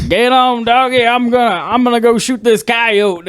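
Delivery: high hammed-up Southern yokel; southern twang. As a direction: put on a big country twang. Mock southern hick quote.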